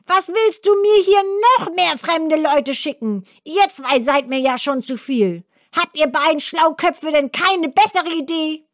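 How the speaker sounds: hohe, keifende Zwergenstimme, schnell und gereizt; zeternder Zwerg. A narrator's reading. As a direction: schimpf wie ein ungeduldiger alter Zwerg. Zwerg schimpft weiter. (hohe, zeternde Zwergenstimme)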